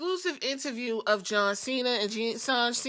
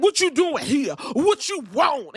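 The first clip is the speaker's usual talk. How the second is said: loud, high mock confrontation; hammed-up furious demand; incensed; 5 semitones higher, 7 dB louder than usual. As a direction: imitate a man shouting in outrage. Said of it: "he's completely incensed".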